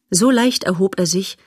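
usual speaking voice

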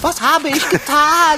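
loud, high and horrified; dismayed cry. Acted. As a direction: gasp it out as the unicorn collapses. Unicorn collapses after his poem. (entsetzt und laut)

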